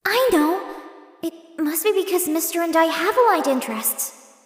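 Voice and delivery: light up as it clicks; excited realization. Acted. Sudden realization, delighted.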